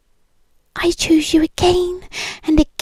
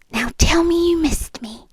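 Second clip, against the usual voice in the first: ordinary delivery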